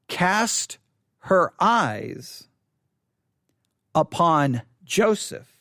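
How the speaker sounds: slow, weighty reading of the verse; solemn Bible reading with long pauses; scripture reading. Acted. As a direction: let each phrase land, pausing long between.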